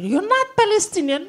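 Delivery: high, loud disbelieving question; can't believe what she heard; shocked question. Retold or imitated. Shocked at the dog's answer.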